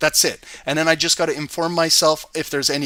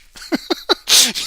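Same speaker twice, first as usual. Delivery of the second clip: a giddy laugh, higher and a bit louder; delighted high laugh; 9 semitones higher, 4 dB louder than usual. Real, not acted. Thrilled by the gold move, delighted high laugh.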